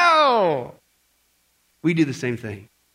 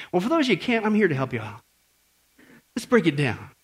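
ordinary talk

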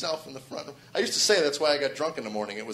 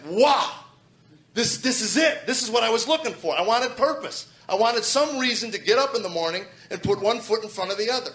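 higher and louder, full of wonder; awed excitement; 8 semitones higher, 6 dB louder than usual. Real, not acted. Man finding meaning in a sermon tape: thrilled. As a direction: let the discovery lift you.

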